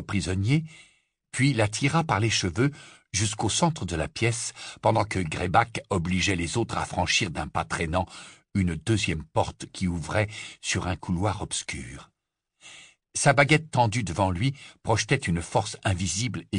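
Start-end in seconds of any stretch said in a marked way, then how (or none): none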